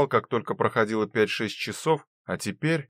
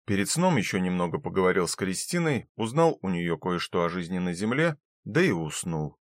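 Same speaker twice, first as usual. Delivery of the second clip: ordinary delivery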